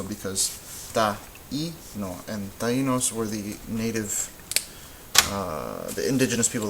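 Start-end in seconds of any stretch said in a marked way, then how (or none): 0.9-2.3 taíno split slowly into ta, EE, no; syllable by syllable. Shown as a demonstration. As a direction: break taíno into three beats with a separate í. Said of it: "You should hear three also".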